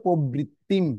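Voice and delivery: প্রবৃত্তিম ending in a clear m; final m sound. Shown as a demonstration. Teacher showing how anusvara sounds, প্রবৃত্তিম with final m. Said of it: "তাহলে উচ্চারণটা কি হবে?".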